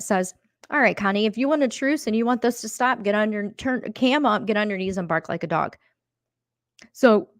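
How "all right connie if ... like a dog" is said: usual speaking voice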